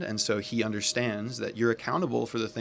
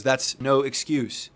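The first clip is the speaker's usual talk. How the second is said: slow, loud firm rebuke; an irritated friend scolding, slow and louder; stern retort; 4 semitones higher, 6 dB louder, slower than usual. Retold or imitated. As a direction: lay down the law, slowly and firmly. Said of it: "John goes".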